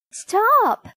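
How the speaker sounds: high-pitched plea for mercy; alarmed cry. A narrator's reading. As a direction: cry out in sudden panic.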